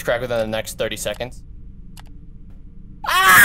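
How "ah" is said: high, loud sudden shriek; startled scream. Real, not acted. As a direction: scream from a jumpscare. Jumpscare scream.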